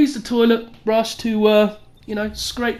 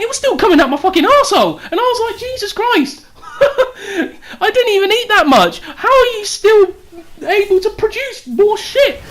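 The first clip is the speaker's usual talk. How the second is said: incredulous; 10 semitones higher, 3 dB louder than usual. Real, not acted. Disbelief at endless diarrhea.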